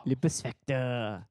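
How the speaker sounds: slow, mock French title; French accent. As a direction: say it like a Frenchman, slowly. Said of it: "they call me French [name]".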